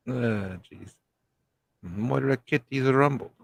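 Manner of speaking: ordinary talk, nothing marked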